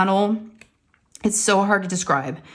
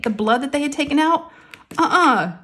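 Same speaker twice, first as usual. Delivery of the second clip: usual speaking voice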